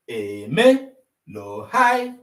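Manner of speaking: Eje low, then hai said high. Shown as a demonstration. Teacher spells the tones, low then high. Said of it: "look at their tone marks".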